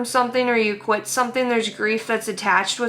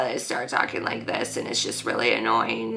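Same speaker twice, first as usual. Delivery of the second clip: much lower and softer, gravelly throat-stuck fry; mocking vocal fry; 12 semitones lower, 8 dB quieter than usual. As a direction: drag the words out of the back of the throat in mock fry. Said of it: "shit stuck in the back of their throat".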